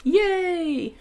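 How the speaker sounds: high, drawn-out yay; long happy squeal; excited cheer. Real, not acted. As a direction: cheer with real delight, pitch gliding down. Cheers friend's return to streaming.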